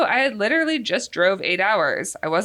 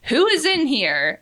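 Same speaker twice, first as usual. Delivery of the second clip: higher, drawn-out mock yell; retold yell; 8 semitones higher, 3 dB louder than usual. Girlfriend demands who is in the house, retold yell. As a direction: raise the pitch and stretch the demand. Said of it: "so I just start yelling".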